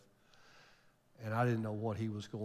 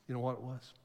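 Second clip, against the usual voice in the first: ordinary talk, nothing marked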